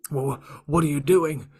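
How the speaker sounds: panicked cry. Acted. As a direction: cry out in alarm. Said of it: "Ultron cried out".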